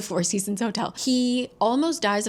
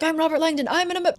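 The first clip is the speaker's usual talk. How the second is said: pitched-up, rushed mock panic; hurried Langdon; 8 semitones higher, faster than usual. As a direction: blurt it out before the crash. Panicked Langdon cut off by taxi.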